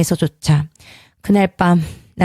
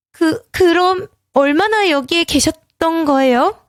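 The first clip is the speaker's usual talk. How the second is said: shaken question; 10 semitones higher than usual. A narrator's reading. Wife asks, shaken.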